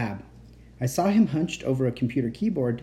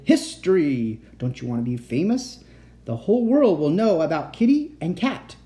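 mildly shouted, building to high and loud; shouted exultation; 4 semitones higher, 4 dB louder than usual. A narrator's reading. Mad uncle promises fame to his captive, shouting. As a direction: proclaim it like a grand announcement. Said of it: "he shouted".

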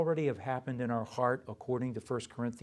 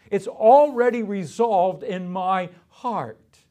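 loud, slow, fervent preaching; 8 semitones higher, 12 dB louder, slower than usual. Acted. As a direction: proclaim it from the pulpit. Pastor preaching at full force.

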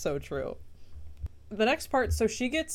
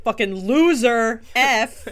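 put-on loud slow sneering mean girl; sneering fake sweet; 5 semitones higher, 10 dB louder, slower than usual. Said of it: "She's like".

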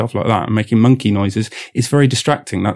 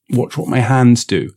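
ordinary talk

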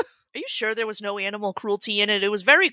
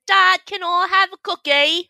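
mock Australian kid, higher and louder; imitating an Australian child asking Dad; Australian kid accent; 8 semitones higher, 4 dB louder than usual. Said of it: "Suddenly all your kids are saying".

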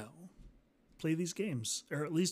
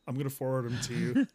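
ordinary talk

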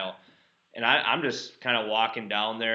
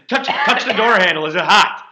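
mock panicked quote; 8 semitones higher, 12 dB louder than usual. Quoting panicky Office scene.

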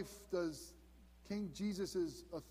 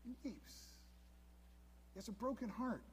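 hushed, near-tearful; 6 semitones higher, 7 dB quieter than usual. Real, not acted. Pastor voices Jesus weeping.

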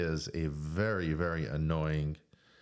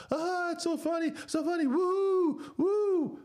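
much higher squealing fake delight; mocking laughing fan; slower than usual. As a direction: ham up a gushing fan. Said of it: "sycophants out there laughing".

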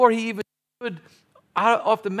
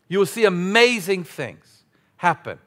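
ordinary talk

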